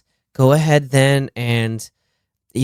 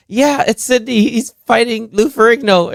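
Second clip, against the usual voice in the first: high-pitched imitation of a flustered caller; mock panicked phone call; 9 semitones higher than usual. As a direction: play-act reporting a fight in alarm.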